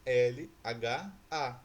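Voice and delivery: slow, loud "L H A", letter by letter; spelled out. Shown as a demonstration. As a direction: spell "L H A" with a gap after each letter. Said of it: "a segunda sílaba é lha". ("L H A" soletrado devagar, letra por letra)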